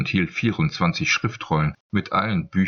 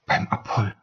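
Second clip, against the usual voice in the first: hushed whisper of alarm; breathless hushed oath; whispered exclamation; 4 semitones higher than usual. Acted. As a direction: whisper it like a sudden remembering. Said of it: "rief sie leise".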